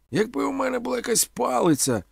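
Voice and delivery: high-pitched theatrical wail; alarmed cry. A narrator's reading. Omela facing a running dog, crying out in alarm. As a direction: wish aloud in high alarm.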